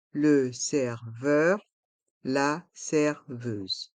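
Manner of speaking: "Le serveur, la serveuse" syllable by syllable, slowly; slow model pronunciation. Shown as a demonstration. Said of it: "Prononçons".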